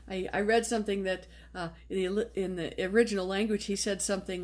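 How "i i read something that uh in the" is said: usual speaking voice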